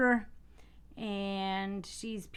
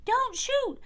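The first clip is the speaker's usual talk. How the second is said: much higher, loud frightened scream; 5 dB louder than usual. Acted. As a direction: scream it in panic. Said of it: "I screamed".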